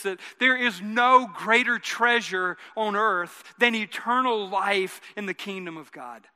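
usual speaking voice

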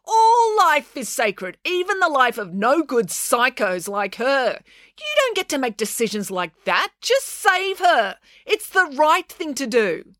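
high, drawn-out moral outrage; exasperated, high-pitched lecture; indignant outburst. Acted. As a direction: scold and insist, stretching the words. April berates Dad to act.

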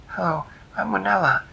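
put-on a young girl introducing herself; a touch of a girl's voice.

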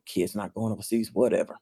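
low, soft offhand brush-off; mock a parent's dismissal under the breath; dismissive parents. Parents waving off the kid's overseas plan, dismissive. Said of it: "parents are like".

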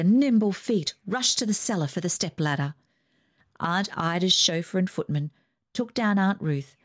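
ordinary delivery

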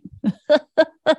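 high bright burst of laughter; real laugh. Real, not acted. Laughing at her guides' joke on her, real laugh. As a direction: laugh out at herself.

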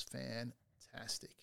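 "fantastic" slowly split, stressing both halves; emphasis on fan and tastic. Real, not acted. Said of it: "Emphasis on the fan and the tastic".

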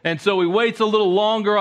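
normal talking voice

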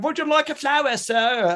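high, put-on Cockney flower girl; bad Cockney accent. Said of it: "really bad Cockney accent".

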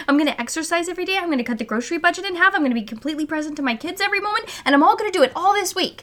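high, rapid list of plans; fast overeager rattle. Retold or imitated. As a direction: rattle them off breathlessly. Mocking overambitious mom plans.

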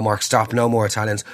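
ordinary talk

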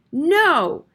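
high, loud, abrupt "no"; almost scream the refusal; near-scream. A narrator's reading. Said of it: "Harriet almost screamed".

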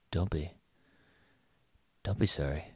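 imitated whisper. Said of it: "but then you whisper it".

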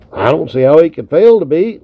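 plain reading voice

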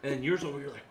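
ordinary talk

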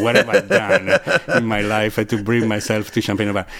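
loud, laughing disbelief at himself; laughing self-quote. Retold or imitated. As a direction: laugh through it, mock despair. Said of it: "I was like".

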